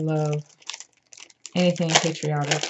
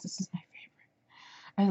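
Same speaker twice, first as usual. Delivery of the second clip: soft, voiceless confiding aside; whispered aside; 7 dB quieter than usual. Real, not acted. Crafter confiding her favorite to viewers, whispered. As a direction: whisper it like a little secret.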